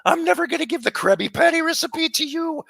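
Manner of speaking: hammed-up high cartoon scolding; cartoon impression. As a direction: rant like a greedy cartoon boss. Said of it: "here's my other one".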